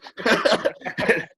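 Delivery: high breathy burst of laughter; real amused laugh; unvoiced laugh. Real, not acted. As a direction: laugh at your own punchline. Laughing at own story.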